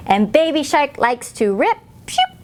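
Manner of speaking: ordinary delivery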